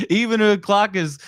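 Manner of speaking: saying "Even a clock is" again through laughter; laughing retry. Real, not acted. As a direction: restart the phrase still laughing.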